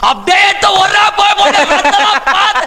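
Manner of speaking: put-on a grieving drunk son shouting about his father; furious drunken shout. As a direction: roar it out in drunken fury.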